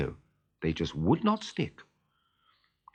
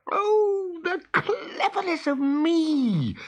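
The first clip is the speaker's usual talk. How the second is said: high, loud, slow boastful crowing; crowing cry; 4 dB louder, much slower than usual. A narrator's reading. Peter delighted with his stuck-on shadow, high crowing. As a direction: crow it out in delight. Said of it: "he cried".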